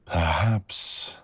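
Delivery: ordinary talk, nothing marked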